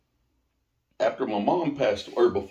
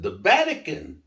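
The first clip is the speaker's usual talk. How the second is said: mock-annoyed answer, a bit louder; irritated retort; 9 semitones higher, 4 dB louder than usual. Annoyed young [name] tells the office lady, a touch indignant. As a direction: snap back the obvious answer. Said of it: "I was starting to get pissed".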